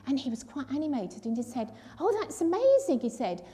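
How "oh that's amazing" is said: delighted exclamation, higher and louder; excited. Retold or imitated. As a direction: marvel like a thrilled old airman. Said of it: "he got quite excited".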